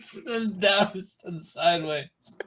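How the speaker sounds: high-pitched Yorkshire echo; mock Yorkshire accent. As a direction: repeat it in a high northern voice. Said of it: "different Yorkshire accents".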